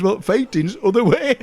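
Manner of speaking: high, laughing mock retort; the general's retort. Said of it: "He says".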